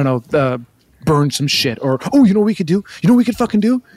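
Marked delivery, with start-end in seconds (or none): 2.1-3.8 manic excitement. Acted.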